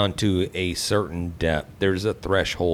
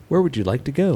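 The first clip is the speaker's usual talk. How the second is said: navigation voice; 9 semitones higher, 4 dB louder, faster than usual. Pretend. Voicing a car navigation system.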